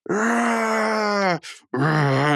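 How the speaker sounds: long, stretched mock slow-mo cry; slow-motion yell. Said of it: "super slow-mo and they're going".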